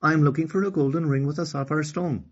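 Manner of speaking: confident Okani. Acted. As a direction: answer firmly like a brave little girl. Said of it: "answered Okani with confidence".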